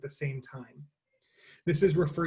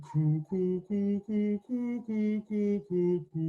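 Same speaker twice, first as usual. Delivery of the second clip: higher sung coo coo, rising and falling back; dove cooing; 5 semitones higher, slower than usual. Shown as a demonstration. Teacher leading the class in dove coos, sung higher. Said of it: "I'll do it once and you repeat it after me".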